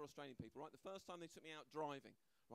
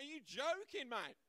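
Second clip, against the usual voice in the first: Australian; 9 semitones higher, 5 dB louder, slower than usual. Retold or imitated. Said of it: "They're like".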